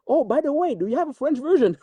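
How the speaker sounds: high, bright eager quoting; eager question. Retold or imitated. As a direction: ask it like an excited studio exec.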